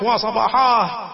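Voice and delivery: slow, drawn-out high alarm call; mock alarm cry. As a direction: raise the alarm to the town. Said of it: "بدأ يصرخ".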